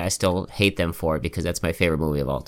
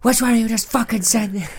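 drill sergeant yell. Retold or imitated. Quoting a drill sergeant clip.

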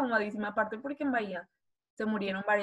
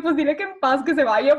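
high, loud scared plea, laughing at the end; frightened pleading; 5 semitones higher, 10 dB louder than usual. Retold or imitated. Girl begging cousin to send the ghost away, loud scared plea. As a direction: beg the ghost to leave. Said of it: "Y yo".

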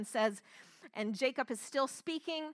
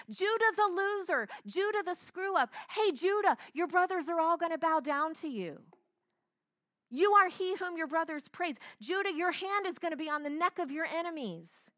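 a higher, louder proud father's blessing; father Jacob blessing Judah; 4 semitones higher, 4 dB louder than usual. Retold or imitated. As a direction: call out to the son like a father who now honours him. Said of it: "that same father who favored Joseph is telling him".

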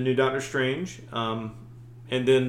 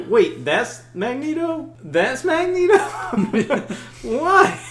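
high, incredulous mock confusion; baffled disbelief; 12 semitones higher, 6 dB louder, slower than usual. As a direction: act totally lost and shocked. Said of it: "they're like".

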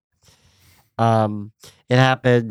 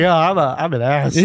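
laughing self-mockery; 5 semitones higher, 4 dB louder than usual.

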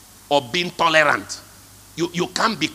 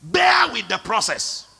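shouted exhortation; 6 semitones higher, slower than usual. Real, not acted. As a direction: preach it out hard.

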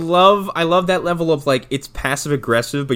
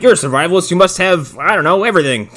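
louder, higher put-on argument; mock insistence; 4 semitones higher, 6 dB louder than usual. Gunman assuming the survivalist has everything, mock insistent. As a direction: press a silly assumption as if obvious.

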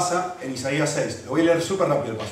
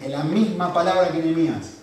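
usual speaking voice

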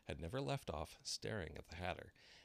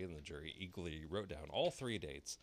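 plain reading voice

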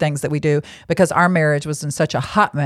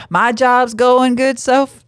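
slow, higher Southern drawl; Southern accent; 6 semitones higher, 4 dB louder, slower than usual. Acted. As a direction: stretch it out like a Southerner. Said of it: "not a Southern voice".

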